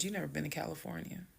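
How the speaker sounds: quick, soft statement of fact; calm, just stating. Retold or imitated. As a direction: say it quietly, no heat at all. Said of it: "I'm very calm".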